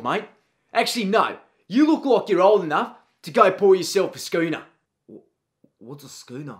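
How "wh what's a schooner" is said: timid question. Acted. Student asks, then repeats.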